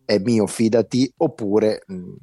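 ordinary delivery

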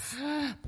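quick, high intake of breath; shocked gasp. Real, not acted. Co-host hears the surgery headline, shocked gasp. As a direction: gasp at the gossip.

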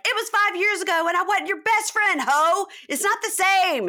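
high, loud indignant yelling, imitated; hammed-up shouted comeback in a quarrel; mock furious outburst. Retelling Lala yelling at her accuser, mock furious.

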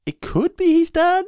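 very high, drawn-out musing; tentative speculation. Retold or imitated. Viewer weighing up the character's design, high-pitched and tentative. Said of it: "enough where you go".

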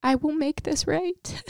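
high, choked-up, slow words on the verge of tears; emotional. Real, not acted. Wife sharing God's answer to her prayer, emotional. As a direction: let real feeling push the voice up. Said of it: "I'm emotional".

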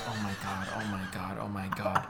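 low, hushed repeated dread; mortified. Retold or imitated. Kid named in front of the whole bus, mortified muttering. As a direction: mutter it under your breath, mortified. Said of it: "I was so mortified".

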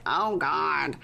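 mock weary dismay in an altered voice; Debbie. Mother on the couch hearing the complaint, mock dismay. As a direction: groan out a put-on dismayed reaction.

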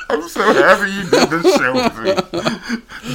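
high, helpless laughing speech; laughing hard. Real, not acted. Host cracking up with guest, talking through laughter. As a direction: squeeze the words out through real laughter.

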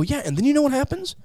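high-pitched, pointed comeback; mock rejoinder. Atheist answering the Christians' objection, in a high mock rejoinder. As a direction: throw the question back way up high. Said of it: "And I go".

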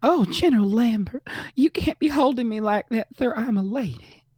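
high-pitched hammed-up Southern belle; Southern accent. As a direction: play a scandalized Southern lady, high. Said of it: "Say it with a Southern accent".

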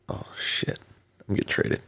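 near-whispered curse under the breath; hushed dread. Retold or imitated. Player dreading a trade call, hushed dread. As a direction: whisper it like bad news just landed.

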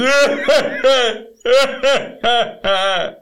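high, heaving mock sobs; hammed-up sobs trailing into a wail; demonstrated sobbing. Shown as a demonstration. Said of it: "Sobbing is".

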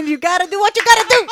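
fast, high, laughing; excited catchphrase. Real, not acted. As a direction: bounce the catchphrase back. Repeats catchphrase loudly.

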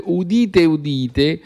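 town crier. Acted. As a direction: call the crowd to listen. Hear ye, hear ye call.

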